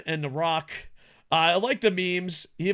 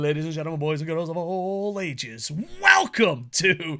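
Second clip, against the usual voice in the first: announcer. Pretend. Host welcoming boys and girls of all ages, announcer style. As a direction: stretch it out like a showman. Opens show as ring announcer.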